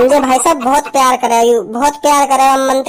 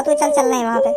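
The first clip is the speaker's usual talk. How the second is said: mock crying; 10 semitones higher, 5 dB quieter than usual. Staged crying at a death.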